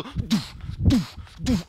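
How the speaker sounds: loud vocal thud, repeated; punchy spoken bump noises; thudding impacts. Acted. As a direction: imitate a frog banging into a lid. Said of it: "der knallt sich immer so".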